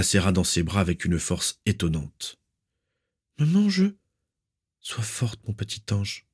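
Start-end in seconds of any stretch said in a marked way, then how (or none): 3.4-3.9 put-on high-pitched, childlike daughter's voice; distressed plea. A narrator's reading. Narcissa in her mother's arms, distressed. 4.8-6.3 very soft, intimate motherly voice; tender murmur. A narrator's reading. Mother comforts her daughter, murmuring in her ear. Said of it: "lui murmura sa mère à l'oreille".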